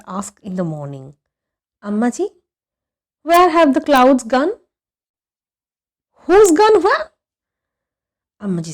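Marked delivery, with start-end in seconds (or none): 1.8-4.5 put-on a boy's voice, louder and higher; eager boy calling. A narrator's reading. A boy calling to his mother, eagerly raised. 6.2-7.1 Ammaji's voice, much higher and louder, incredulous; baffled mother. A narrator's reading. A mother startled by her son's odd question, incredulous. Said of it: "as if he had asked an exceptionally stupid question".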